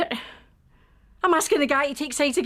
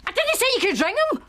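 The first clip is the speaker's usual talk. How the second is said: high, sharp cry of protest; alarmed protest; 9 semitones higher, 3 dB louder than usual. Acted. To Bernie as he phones, raised alarmed protest. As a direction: snap it out in alarm as he dials. Scarlett objects to the phone call.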